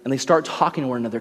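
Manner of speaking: ordinary talk, nothing marked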